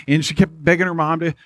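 ordinary talk

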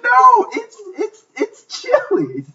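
high, slow, laughing plea; mock pleading with grandma; pleading family. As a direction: beg her like a pleading grandchild. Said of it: "We're like".

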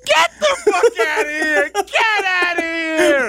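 high, drawn-out shout of disbelief; disbelieving shout. Real, not acted. Host hearing his cohost was turned down over a dream, shouting. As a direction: yell it out, can't believe it.

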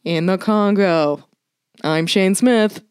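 very slow, low, robotic presenter sign-off; Shane Smith. Acted. Podcaster mocking a host who doesn't talk like a human, robotic presenter impression. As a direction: draw each word out in a stilted mock-anchor voice. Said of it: "He doesn't talk like a human".

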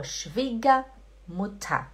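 Schwiegermutter slowly, as "shvee-gah-moo-tah"; R sounded as A. Shown as a demonstration. Teacher answers a student's question, Schwiegermutter slow with "ah" endings. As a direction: open "ah" for each ER in Schwiegermutter. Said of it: "você faz com som de A".

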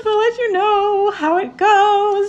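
excited. Acted. Playful sign-off to viewers.

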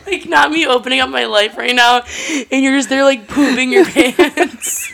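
loud teasing that breaks into laughter; amused mock outrage. Real, not acted. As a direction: scold a friend while cracking up. Teasing co-host while cracking up.

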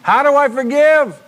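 high, drawn-out cry of a question; loud, high plea for an answer; loud acted questioning. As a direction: call it out slowly as a struggling listener. Voices the listeners' question loudly.

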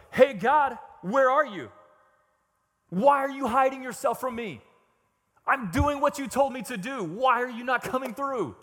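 loud, high-pitched demand to God; exasperated outcry. Acted. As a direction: call God out, voice right up. Acts David's outburst at God.

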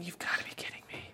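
breathless whispered thought; inner thought. Acted. Alyssa reacting silently to caller, exasperated whisper.

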